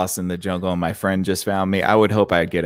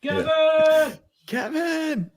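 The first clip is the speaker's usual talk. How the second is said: mock scream; much slower than usual. Echoing friend's mock scream.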